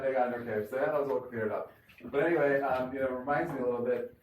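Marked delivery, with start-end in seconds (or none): none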